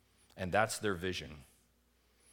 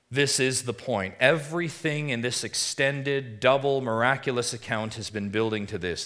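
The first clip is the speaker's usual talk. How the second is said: forceful preaching; 4 semitones higher, 7 dB louder than usual. Acted. Sermon climax.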